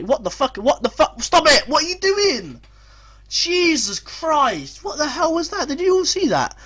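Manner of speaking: high-pitched panicked yelling; shocked, shrill-pitched outburst; alarmed disbelief. Real, not acted. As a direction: cry out in horror at your own defenders. Alarmed by defenders' near disaster.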